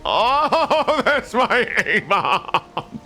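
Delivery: slow, high, laughing delight; high, drawn-out, amused recognition. Real, not acted. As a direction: laugh through the words fondly. Laughs recognising friend's build.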